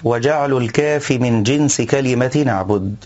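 normal talking voice

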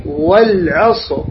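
"والعصر" slowly, ending on a vowelless heavy R; heavy silent R. Shown as a demonstration. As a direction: model "والعصر" slowly with thick final R. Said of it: "الراء هنا ساكنة". ("والعصر" ببطء مع راء ساكنة مفخمة)

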